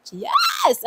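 very high, loud yell of victory; triumphant shout. Real, not acted. Vlogger shouting in triumph at finishing the challenge. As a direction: shout it out, you won.

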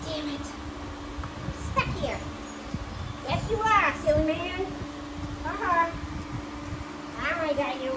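loud, triumphant teasing laughter; gloating. Real, not acted. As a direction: gloat over the trapped horse. Gloating at trapped horses.

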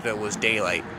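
plain reading voice

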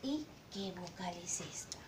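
hushed quiz question; whisper. Shown as a demonstration. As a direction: whisper it to the kids like a secret. Teacher quizzing children softly.

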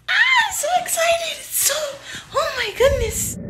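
high, breathy, loud starlet squeal; Marilyn Monroe voice. Acted. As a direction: gush like a bombshell starlet. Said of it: "I wanna do an impression of her".

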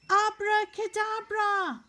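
big booming magic word; magic shout. Acted.

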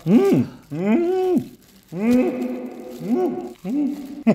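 chewing fried chicken; talking while eating; mouth full. Real, not acted. Seen 2: bites chicken at +0.1–0.4, food visible in mouth at +1.3.

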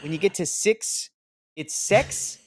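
mock New Zealand accent, counting high; hammed-up New Zealand accent counting. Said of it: "audition in a New Zealand accent".

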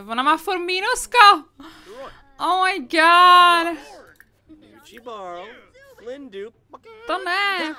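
high, loud exasperated cry; stretched-out oh my god in dismay; alarmed outburst. Real, not acted. Gamer shrieks as her Sim's mood tanks. Sim keeps getting negative mood.

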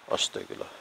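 breathless whispered prayer. Real, not acted. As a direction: whisper it in fear in the dark. Scared muttered prayer at night.

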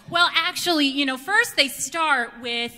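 mock smug expert; know-it-all. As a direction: lecture them like a superfan. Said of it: "I literally go".

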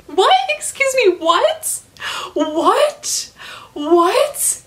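stunned, laughing disbelief; incredulous. Real, not acted. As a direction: repeat it in rising shock. Incredulous at book plot.

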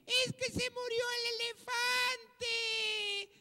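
slow, hammed-up tearful wail; mock crying. Zookeeper explaining his loss to a man, mock sobbing. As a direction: wail it out, drawing the words long. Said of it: "que estaba llore y llore".

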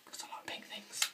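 whispering. Pretend. Arguing over pink Game Boy.

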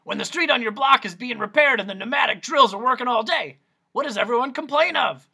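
loud high doctor; Doctor Discord. Acted. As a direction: quiz the boy like a showman. Doctor quizzing.

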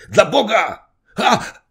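high, loud gasped exclamation; panting, broken voice. A narrator's reading. Puffing Zagłoba protests as he is dragged to cover, panting outburst. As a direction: burst out, puffing and exasperated. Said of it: "mówił przerywanym głosem, sapiąc pan Zagłoba".

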